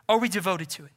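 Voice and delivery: high, driving pulpit voice; full-force preaching. Acted. Preacher urging the whole church to pray, forceful preaching.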